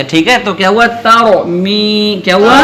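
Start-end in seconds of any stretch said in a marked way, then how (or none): 0.9-2.2 slow, raised chant of تارو می with the "mee" held long; drawn-out tajweed. Shown as a demonstration. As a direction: recite تارو می slowly, full "ro", stretched "mee". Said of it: "ایک الف کے برابر کھینچ کے پڑھتے ہیں". (تارو می، یا کھینچ کر)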